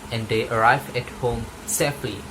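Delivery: ordinary delivery, nothing marked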